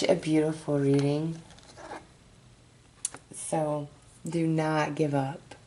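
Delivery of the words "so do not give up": ordinary talk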